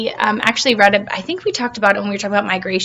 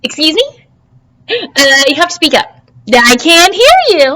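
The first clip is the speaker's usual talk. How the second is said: louder, higher impatient asking; 6 semitones higher, 6 dB louder than usual. Acted. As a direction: demand louder. Plankton straining to hear.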